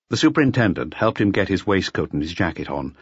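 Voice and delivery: ordinary delivery, nothing marked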